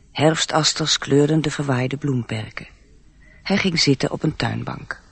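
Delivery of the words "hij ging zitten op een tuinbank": ordinary talk, nothing marked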